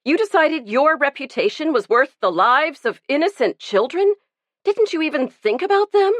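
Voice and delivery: a woman rising to a high, incredulous outrage; outraged. A narrator's reading. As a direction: hurl the questions at him, appalled.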